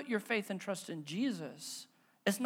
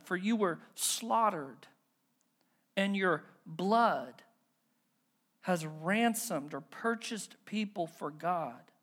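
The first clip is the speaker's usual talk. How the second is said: slow solemn reading; slower than usual. Real, not acted. Reading scripture with weight.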